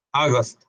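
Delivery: August with O then A sounds, falling; English word demo. Shown as a demonstration. Teacher repeats the word while explaining vowels. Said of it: "y una A".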